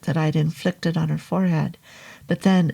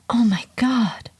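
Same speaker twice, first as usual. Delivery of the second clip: higher breathy gasp of shock; horrified whisper; 5 semitones higher than usual. Acted. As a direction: whisper it in horror. Shocked at transformation.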